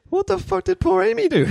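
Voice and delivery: high-pitched, acted exasperated question; mock indignation. Voicing indignant reaction to setup.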